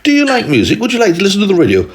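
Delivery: put-on high, quick eager girl; little girl voice. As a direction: ask brightly like a little girl. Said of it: "Henrietta said to the alien".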